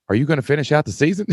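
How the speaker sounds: lightly voiced principal asking about the season; quoting the principal. Principal asks the coach about the season, lightly voiced. Principal's first question quoted.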